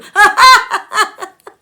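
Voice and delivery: big high hearty guffaw; loud high laugh. Acted. Narrator bursting into the nephew's laugh. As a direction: let a huge laugh burst out.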